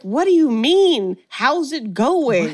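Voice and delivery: slow, incredulous what-do-you-mean. Retold or imitated. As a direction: stretch it out like you can't believe she asked. Needy baffled by Jennifer's greeting.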